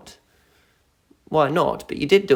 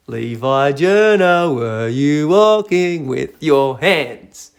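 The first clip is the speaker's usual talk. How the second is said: slow teasing question; 6 dB louder, much slower than usual. Real, not acted. Drawn-out teasing question to viewer.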